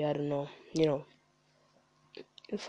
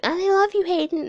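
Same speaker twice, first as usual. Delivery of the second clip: high squealing mock fangirl, louder; gushing fan; 7 dB louder than usual. Mocking his fans to the listener, high gushing squeal. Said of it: "They're like".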